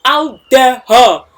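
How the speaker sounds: loud, slow, thick indignant voice; outraged royal bellow, drawn out; thick-voiced kings. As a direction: boom it out like an offended old king. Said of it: "their tick, tick voice".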